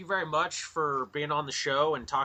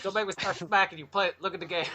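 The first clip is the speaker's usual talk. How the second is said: playfully bully people into playing; mock yelling; 8 semitones higher, faster than usual. Host jokingly threatening people into playing, mock yelling. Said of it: "yelling at people like".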